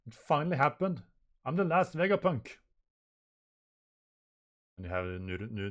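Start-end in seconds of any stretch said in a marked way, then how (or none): 0.1-2.6 high, loud, excited declaration; dramatic declaration. Acted. Last Vegapunk announces the signal is gone, excited. Acting out dramatic manga dialogue.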